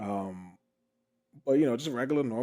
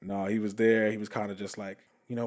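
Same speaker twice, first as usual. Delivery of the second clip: plain reading voice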